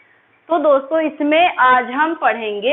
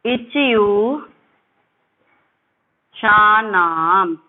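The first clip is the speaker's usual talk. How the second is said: each word stretched out, spaced by pauses; chant-like teaching of a formula, very slow; slow recitation; 3 semitones lower, much slower than usual. Shown as a demonstration. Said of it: "मेरे साथ में आप उसको बोल लोगे". (धीरे-धीरे खींचकर सूत्र-पाठ)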